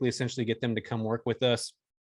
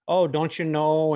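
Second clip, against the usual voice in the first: slow, drawn-out Fargo-style Midwestern vowels, pitched up; a friendly Minnesota-nurse Fargo accent, lightly done; imitating a Fargo accent; 4 semitones higher, 5 dB louder, slower than usual. Quoting a travel nurse to the host, in a mock Fargo accent. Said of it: "with these, like, Fargo type accents".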